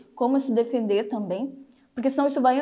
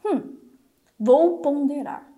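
slow deliberate thinking; 3 semitones higher, much slower than usual. Shown as a demonstration. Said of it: "Então o meu cérebro já pensa o quê?".

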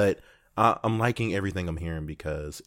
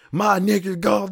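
mock Colombian accent, much higher and louder; 13 semitones higher, 10 dB louder than usual. Passenger mimics roommate's Colombian accent, loud and high. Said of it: "he has, like, a Colombian accent".

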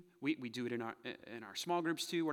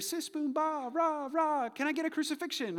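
high, loud pep-rally chant; mock cheerleader; 13 semitones higher, 6 dB louder than usual. Religious cheerleaders rousing the crowd, high mock cheer. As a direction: lead a sarcastic cheer at high pitch. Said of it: "the religious cheerleaders".

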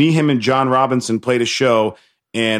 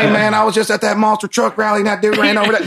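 put-on hillbilly accent; 8 semitones higher than usual. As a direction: talk like a monster truck fan. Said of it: "the French are just like".

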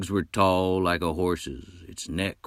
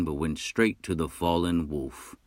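ordinary delivery, nothing marked

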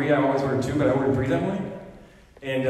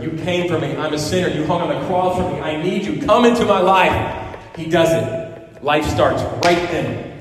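loud, higher, impassioned sermon delivery; full-force preaching; 4 semitones higher, 5 dB louder than usual. Acted. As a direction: preach it with rising fire.

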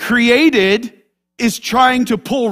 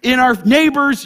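ordinary talk, nothing marked

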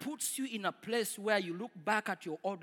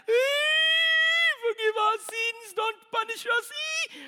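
high, loud mock crying plea; imitated wailing; 12 semitones higher, 8 dB louder, slower than usual. Preacher imitating people crying at the camp. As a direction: wail like a weeping camp crowd. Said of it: "they were crying the whole night".

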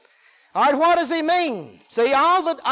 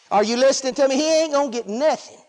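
ordinary delivery

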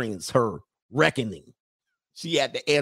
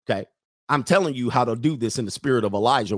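ordinary talk

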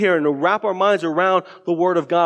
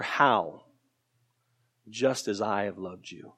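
soft emphatic answer; 7 semitones lower, 6 dB quieter, slower than usual. Acted. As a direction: lower and much softer on Just as I have loved you. Quoting Jesus, answering own question.

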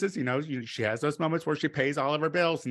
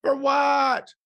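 very high indignant cry of disbelief; for what, squealed sky-high then shouted; outraged shriek; 11 semitones higher, 6 dB louder, much slower than usual. Retold or imitated. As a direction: shriek it, scandalized. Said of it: "I'm like".

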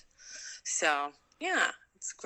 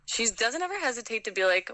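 ordinary talk

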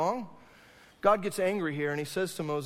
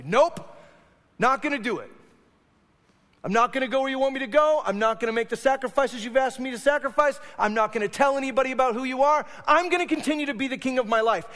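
blunt refusal; 7 semitones higher, 8 dB louder, faster than usual. Retold or imitated. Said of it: "look at God and say".

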